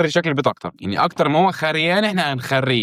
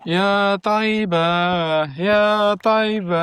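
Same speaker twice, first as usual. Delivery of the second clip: mock sing-song call; 7 semitones higher, much slower than usual. Calling out to in-game mob.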